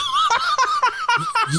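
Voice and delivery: a high, squealing burst of laughter; helpless high-pitched giggle. Real, not acted. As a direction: laugh high up at the absurd warning. Laughing at pen cap warning.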